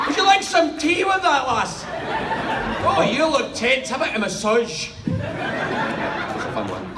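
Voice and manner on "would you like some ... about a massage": high, loud, hammed-up Scottish accent; exaggerated Scottish tough guy, loud and high. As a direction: play a tough Scot, pitched up and loud. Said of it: "a fist tough Scottish voice".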